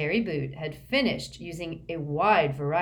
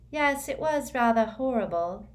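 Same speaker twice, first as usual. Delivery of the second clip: conversational; 6 semitones higher than usual. A narrator's reading. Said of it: "said Luna conversationally".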